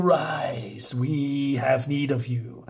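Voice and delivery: slow, ghostly whispered summons; eerie whisper. Acted. Spirits summon the pilgrim in a slow ghostly whisper.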